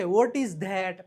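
what is that said very slowly; slow model. Shown as a demonstration. Teacher modelling the question slowly for students. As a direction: spell it out slowly for the class. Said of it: "teacher models English phrase".

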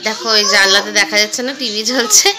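usual speaking voice

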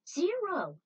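high, loud call of his name; calling Zero's name across the field. Acted. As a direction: shout to catch his attention. Calling out to Zero.

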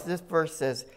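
ordinary talk

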